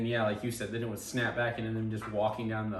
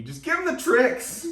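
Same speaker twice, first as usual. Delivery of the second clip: high, loud TV-heckler yell; mock scream; 10 dB louder, slower than usual. Imitating co-host screaming at the TV, mock scream. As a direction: scream it slowly at the screen. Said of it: "screams at the T V".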